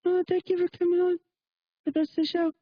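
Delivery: very high put-on woman's voice; mocking female radio host. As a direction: imitate a flustered woman host up high, halting. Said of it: "all she said was".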